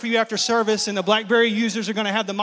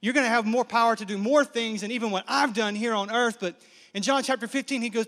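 usual speaking voice

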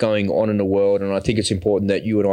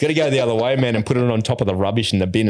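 normal talking voice

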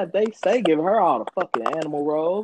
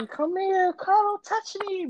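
mock clingy partner.

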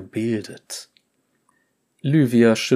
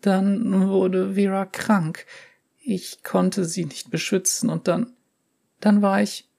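trembling voice; 9 semitones higher than usual. Acted. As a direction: let the voice tremble as she breaks. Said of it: "schlich sich ein Zittern in ihre Stimme".

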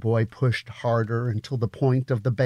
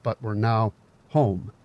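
ordinary delivery, nothing marked

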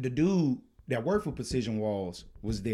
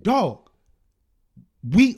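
high, loud exasperation; snapping; 7 semitones higher, 11 dB louder than usual. Retold or imitated. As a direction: start in hot, then stall. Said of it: "I just snapped".

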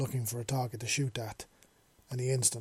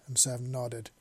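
ordinary delivery, nothing marked

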